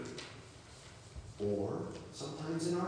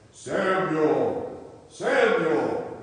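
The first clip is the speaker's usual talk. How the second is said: loud, drawn-out call of a name; booming voice calling; 2 semitones higher, 8 dB louder, much slower than usual. Acted. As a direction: call out loudly like the voice from above. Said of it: "I don't know if it sounded like that".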